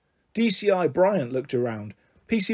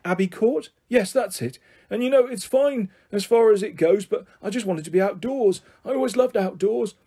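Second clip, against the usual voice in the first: lightly voiced eccentric old man, raised pitch; rambling grandpa; 5 semitones higher than usual. Grandpa tells children why he went camping, rambling. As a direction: ramble on earnestly about the outdoors. Grandpa character explains himself.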